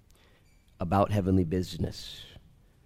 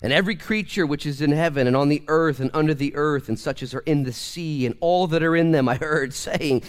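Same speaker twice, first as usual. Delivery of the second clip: high, loud pulpit preaching; fiery preacher driving the point home; full-force preaching; 9 semitones higher, 8 dB louder than usual. Acted. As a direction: preach it out to the back row. Scripture read at preaching force.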